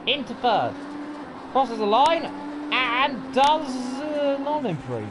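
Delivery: racing commentator, high and lively; live play-by-play. Acted. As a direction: call every gear change with urgency. Holds live race commentary.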